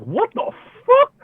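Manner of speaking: very high, slow, disbelieving shriek; panicked. Retold or imitated. Panics as others stay calm.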